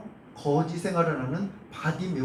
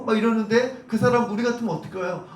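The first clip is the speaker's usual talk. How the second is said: usual speaking voice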